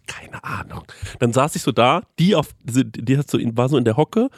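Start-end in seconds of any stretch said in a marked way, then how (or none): 0.0-0.8 toneless whisper. Retold or imitated. Customer answering the waitress, whispered. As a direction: shrug it off in a whisper. Whispering back during the film.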